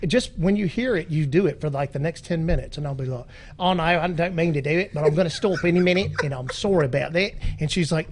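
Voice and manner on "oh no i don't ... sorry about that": exaggerated Aussie apology; lively mock-Australian accent. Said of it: "I'll be talking like this".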